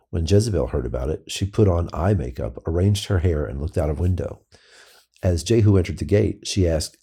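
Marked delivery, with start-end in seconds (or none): none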